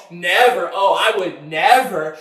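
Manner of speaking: loud, drawn-out "never"; self-assured vow. Retold or imitated. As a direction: imitate an overconfident "Oh, I would never". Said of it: "I used to say".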